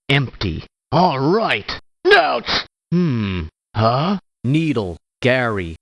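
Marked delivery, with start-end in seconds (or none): 2.1-2.7 high, sharp ouch of pain; quick, stung cry of ouch. Acted. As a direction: yelp it like you just got hit. Pain reaction when hit.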